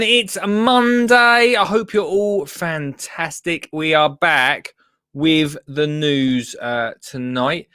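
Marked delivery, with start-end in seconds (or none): 0.0-3.7 loud, high, drawn-out greeting; hyped show opening. Real, not acted. Host greeting viewers at the top of the show, hyped. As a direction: call out to the audience, big and high.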